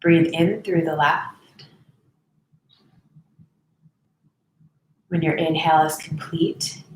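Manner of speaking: even, softening voice with space to breathe; guided meditation. Real, not acted. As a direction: guide them unhurriedly through each breath.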